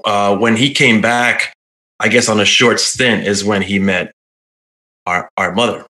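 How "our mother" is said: ordinary talk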